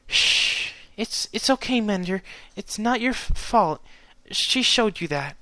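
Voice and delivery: high, halting voice of comfort; shaken but soothing. A narrator's reading. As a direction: shush and reassure while rattled. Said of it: "in a shaken but soothing voice".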